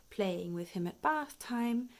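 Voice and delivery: usual speaking voice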